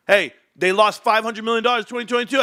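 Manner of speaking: heckler's shouted objection, mock; mock critic shouting. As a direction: yell like a heckler. Voicing a critic.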